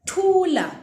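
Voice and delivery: "Thula" high and loud, strong breathy th; teacher models hush word emphatically; loud aspirated th. Shown as a demonstration. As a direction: command "Thula" with a hard puff of air. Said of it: "Do you hear the ta".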